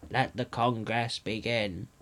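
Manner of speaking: slow, soft royal welcome; dummy king's voice. A narrator's reading. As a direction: speak slowly and softly as a hidden king. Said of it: "there came a voice".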